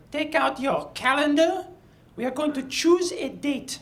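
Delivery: commanding French Madame; French accent. Retold or imitated. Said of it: "she spoke like this".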